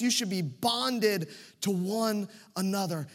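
rally the room, pressing each question home; preaching at full force. Acted. Pastor stirring his congregation toward unity, preaching at full force.

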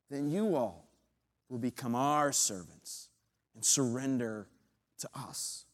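mock them, then drop to a sneering growl; Goliath setting the terms of the duel, arrogant sneer; arrogant giant taunt. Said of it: "arrogance and anger in his voice".

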